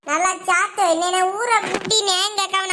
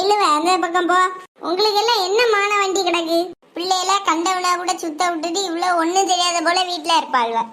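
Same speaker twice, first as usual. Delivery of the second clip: ordinary talk, nothing marked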